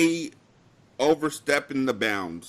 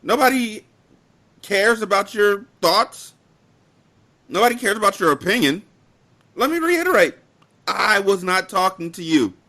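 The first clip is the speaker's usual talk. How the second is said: blunt retort; 5 semitones higher than usual. Retold or imitated. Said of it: "ever so gently and bluntly said".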